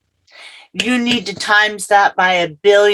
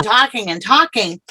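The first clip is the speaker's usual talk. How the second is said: ordinary talk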